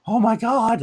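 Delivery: high-pitched mock starstruck gasp of words; imitated starstruck awe. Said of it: "Like, she was starstruck".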